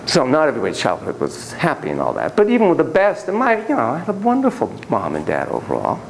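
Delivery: normal talking voice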